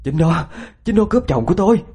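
high, insistent outburst; frantic accusation. Acted. Wife insisting the stranger stole her husband, high and frantic. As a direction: hammer the accusation, pitch soaring.

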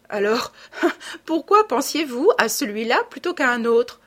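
petit rire puis question amusée; en riant. A narrator's reading. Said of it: "dit-il en riant". (Lupin taquine le narrateur en riant)

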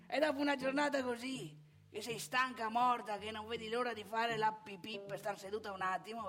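ordinary delivery

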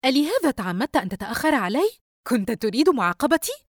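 high, loud, edgy accusation; irritated, edgy. A narrator's reading. Sister accusing brother of being late deliberately, irritated. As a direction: accuse him with frayed nerves. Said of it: "يسرى بعصبية".

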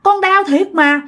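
high, quick faked plea to go to hospital; pretend pleading. Mother faking labor pains, begging grandma, high and hurried. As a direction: beg like you can't bear it, but it's a put-on. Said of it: "mẹ mới nói rằng".

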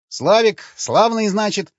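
boastful, beaming self-introduction; proud. A narrator's reading. Slavik proudly introducing himself to the women. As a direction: puff up and grin as you say your name. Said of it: "гордо произнёс он".

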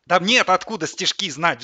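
loud, high howl of protest; wailing. A narrator's reading. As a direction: howl in exasperation. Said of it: "взвыл Сидор". (взвыв, с надрывом)

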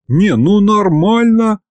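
indignant. A narrator's reading. Boss scolds his demon subordinate, indignant. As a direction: draw it out, affronted. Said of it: "возмутился я".

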